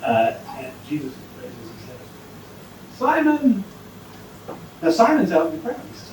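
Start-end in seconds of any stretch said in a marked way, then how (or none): 3.0-3.6 calling out. Acted. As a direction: summon him from the cross. Jesus calls from the cross.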